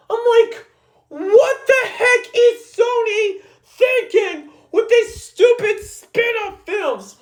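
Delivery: angry ranting. Real, not acted. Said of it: "did not hear me screaming out loud or yelling".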